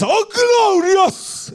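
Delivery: long drawn high mock cry of glory; mock glory shout. Pastor mocking showy worshippers, drawn-out high mock glory cry. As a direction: parody a showy worshipper stretching it out. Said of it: "Quando a gente diz".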